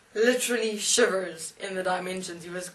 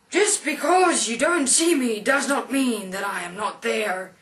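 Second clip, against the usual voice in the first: loud, raised, booming rebuke; thundering angel; 4 semitones higher, 7 dB louder than usual. Retold or imitated. Angel rebuking the atheist who didn't see God, thundering. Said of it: "Then I, and I said".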